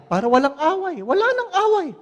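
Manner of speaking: climbing to a very high pitch; playful squeaky-high resolve; hammed-up high voice. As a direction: say it comically high, climbing on the repeat. Comic resolve to avoid a quarrel.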